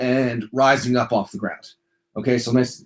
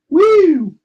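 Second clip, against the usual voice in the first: excited whoop; 7 dB louder than usual. Real, not acted. Pumped up cheer mid-workout.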